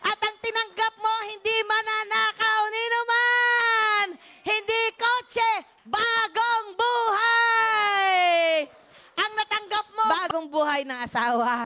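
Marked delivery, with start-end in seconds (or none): 1.3-8.6 slow, drawn-out, louder declaration; stretched preaching emphasis; slow emphatic proclamation. Real, not acted. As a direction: lean on every word of the promise. Preacher building to climax.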